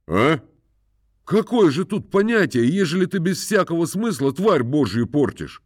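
high, indignant lecturing gentleman; indignant master. A narrator's reading. Master scolds about the bird. (барин возмущённо отчитывает, голос выше)

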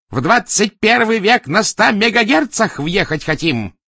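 slow, high, loud rhetorical rage; furious shout. A narrator's reading. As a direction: yell it at the subordinate word by word. Enraged boss berates subordinate. (яростно, криком)

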